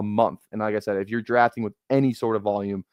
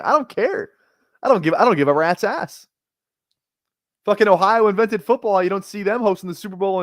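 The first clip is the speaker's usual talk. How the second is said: heated hockey rant; 9 semitones higher, 4 dB louder than usual. Real, not acted. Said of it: "we're gonna go on a hockey rant".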